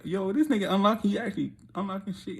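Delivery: retelling a thrilled discovery; excited realization. Retold or imitated. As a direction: blurt it out in amazement. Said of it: "now I'm actually like".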